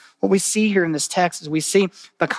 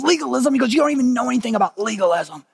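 incredulous friend; 7 semitones higher, faster than usual. Retold or imitated.

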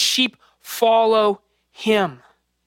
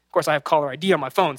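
ordinary talk

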